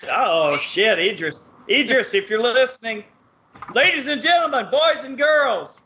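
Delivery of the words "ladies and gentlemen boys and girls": fight announcer. Pretend.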